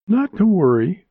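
high-pitched woman character's voice; high, reassuring female-character voice. A narrator's reading.

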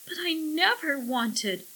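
high, plaintive protest; starting to sob. A narrator's reading. Millie protests to the parrot, starting to sob. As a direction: protest on the edge of tears. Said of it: "she started sobbing".

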